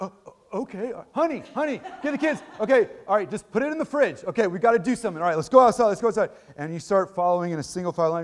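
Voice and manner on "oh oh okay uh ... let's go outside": high, loud mock panic; flustered adult. Grown man flustered by his old teacher at the door, high mock panic. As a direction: scramble to obey like a flustered grown-up. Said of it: "Can you imagine if as a forty-five-year-old like".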